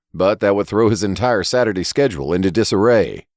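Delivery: ordinary delivery, nothing marked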